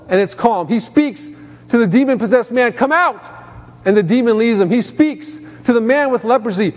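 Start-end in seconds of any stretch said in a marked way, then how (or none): 2.7-3.3 higher, firm command. Acted. Preacher voicing Jesus to the demon, higher and commanding. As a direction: order it out.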